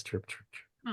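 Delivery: soft unvoiced imitation of crickets; whispered cricket chirps. Nobody reacts to his issue, whispered cricket chirps. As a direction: breathe the chirps like an empty room. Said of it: "just get the kind of crickets".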